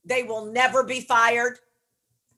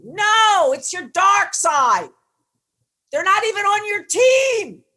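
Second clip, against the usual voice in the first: emphatic shout; 9 semitones higher, 6 dB louder, slower than usual. Real, not acted. Coach warning about the inner voices.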